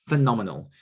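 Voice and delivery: normal talking voice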